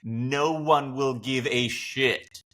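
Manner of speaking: normal talking voice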